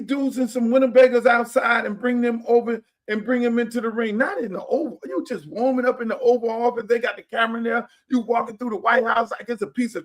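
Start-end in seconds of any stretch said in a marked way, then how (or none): none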